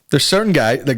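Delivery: ordinary talk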